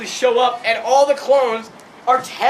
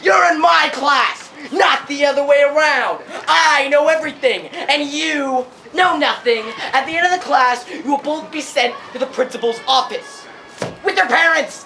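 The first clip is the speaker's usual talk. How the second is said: mock stern teacher; 5 semitones higher, 4 dB louder than usual. Teacher scolds pupils in his class, pompous mock-angry yell. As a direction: imitate a teacher laying down the law.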